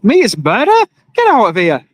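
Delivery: "Meat is murder?" as a scoffing question, then a dismissive "Get out of here"; mock scoffing worker. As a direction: lightly act out an annoyed worker brushing off the sign. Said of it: "I'd be thinking to myself".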